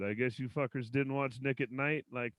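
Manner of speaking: usual speaking voice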